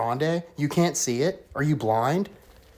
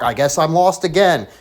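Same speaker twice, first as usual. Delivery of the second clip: usual speaking voice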